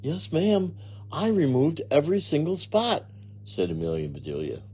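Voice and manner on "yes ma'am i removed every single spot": innocent obliging maid. Acted.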